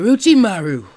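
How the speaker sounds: a scared man covering with bluster; nervous loud businessman. Acted. Startled Gato greeting Orochimaru.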